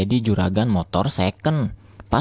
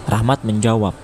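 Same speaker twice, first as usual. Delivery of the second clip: plain reading voice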